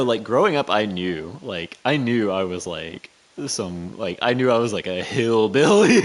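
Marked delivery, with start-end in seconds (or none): 4.7-6.0 loud hillbilly twang tipping into laughter. Acted. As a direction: exaggerate a hillbilly accent. Mocks own hillbilly speech, laughing.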